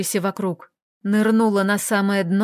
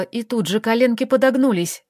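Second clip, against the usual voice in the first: usual speaking voice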